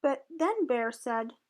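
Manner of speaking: ordinary delivery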